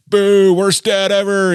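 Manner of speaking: loud, high, drawn-out jeer; mock crowd booing. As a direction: heckle like a whole section of fans. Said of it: "the whole section started booing me".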